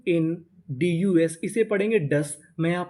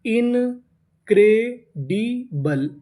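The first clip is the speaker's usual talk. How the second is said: Incredible very slowly in four separate parts; syllable by syllable; 3 semitones higher, 3 dB louder, much slower than usual. Shown as a demonstration. Teacher builds a long word, incredible slowly in pieces. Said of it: "तो meaning क्या बनी?".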